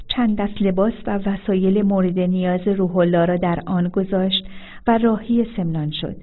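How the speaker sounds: ordinary delivery, nothing marked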